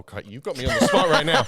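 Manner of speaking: laughing. Real, not acted. Flustered laughing.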